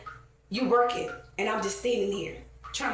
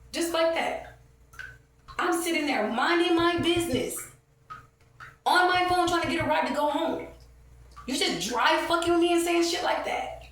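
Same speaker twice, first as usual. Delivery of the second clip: heated angry rant; 8 semitones higher than usual. Real, not acted. Angry recounting of store confrontation.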